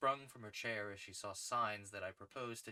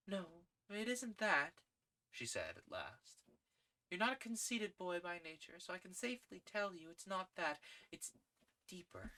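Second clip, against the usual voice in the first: put-on higher young woman's voice, kindly; Gladys gentle; 9 semitones higher than usual. A narrator's reading.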